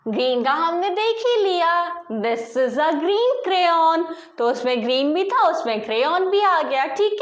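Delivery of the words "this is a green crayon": very slow, each word spaced out; teacher modelling a sentence; slow model sentence. Shown as a demonstration. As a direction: read it out slowly for the class. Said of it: "तेज से बोलना है".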